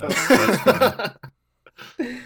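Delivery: laughing it off, high and loud; stammering through a laugh. Real, not acted. Told he's off the show.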